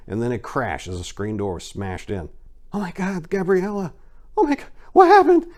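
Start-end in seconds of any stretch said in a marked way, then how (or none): 2.7-5.5 terrified. A narrator's reading. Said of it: "Maria whispered to herself, suddenly terrified".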